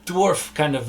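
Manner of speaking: usual speaking voice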